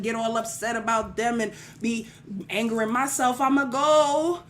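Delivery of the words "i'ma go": usual speaking voice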